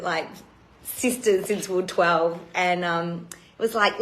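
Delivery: ordinary talk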